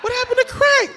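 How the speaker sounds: high-pitched, baffled questioning; mock confusion. Puzzling over crack while Googling, hammed-up confusion. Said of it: "I'm so confused".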